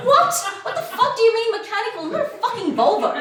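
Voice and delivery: loud, high, fast indignant outburst; mock outrage. Woman to German date asking if it's mechanical, mock outrage. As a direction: explode in comic disbelief.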